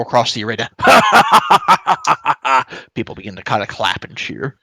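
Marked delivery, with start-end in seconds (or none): 0.7-2.9 loud, high, long stage laugh; big rolling laugh for the crowd; dwarf's hearty laugh. Acted. Dwarf host laughs with the arena crowd, hearty and loud.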